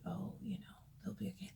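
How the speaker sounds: imitating a soft, forgiving voice; mock sweet whisper. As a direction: whisper it like a pushover. Imitating a nice person.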